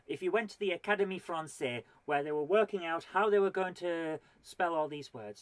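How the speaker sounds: ordinary talk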